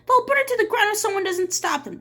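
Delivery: high, pressing plea; urgent. Acted. Miss Catherine urging the sheriff to act, high and loud. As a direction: rush the warning out.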